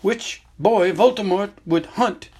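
put-on an old headmaster explaining gently but seriously; Dumbledore. Headmaster tells student about the hidden cave, grave and measured.

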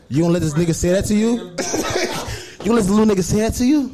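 fast, high egging-on; hyped-up friend. Retold or imitated. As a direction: goad her on quickly. Friend eggs her on.

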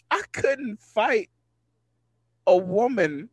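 high-pitched, halting self-defense; mock helpless protest. Defending himself to teasing buddies, exasperated mock protest. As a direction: plead your case, stumbling over the start.

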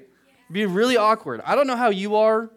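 high, loud laughing exclamation; animated outburst. Real, not acted. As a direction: burst out with amused awkwardness. Laughing aside about awkward crying.

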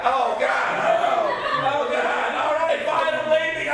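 high, wailing exasperation; disgusted outburst. Acted. Grossed-out daughter announcing she is leaving, high and loud. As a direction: give up loudly in revulsion.

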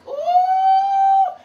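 sudden high falsetto ooh; high whoop. Real, not acted. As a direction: let out a joyful high whoop. Preacher reacts to gossip.

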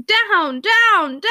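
high shout, repeated with falling tune; reiterated shouted command. A narrator's reading. Backing away from the dog with a stick, alarmed shout. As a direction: shout the order over and over. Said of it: "at the same time reiterating".

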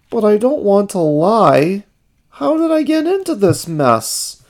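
very high, fretful character voice; high-pitched puppet-like worry; Ernie, troubled. A narrator's reading. Ernie agonizes alone.

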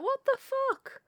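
high, loud disbelieving rant; mock bewildered coworker, high and loud. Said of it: "Just like".

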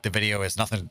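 ordinary talk, nothing marked

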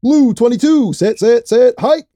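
loud, high playful snap count; mock quarterback call. Joking around like a quarterback at the line, loud and high. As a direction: bark the play like a quarterback.

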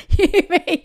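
ordinary talk

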